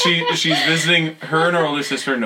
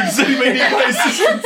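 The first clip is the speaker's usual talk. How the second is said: laughing mock offer; 12 semitones higher, 5 dB louder than usual. Mock first aider offer.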